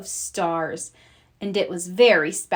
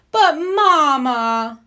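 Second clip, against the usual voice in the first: whining plea; 6 semitones higher, 6 dB louder, much slower than usual. Acted. Shocked cubs protest.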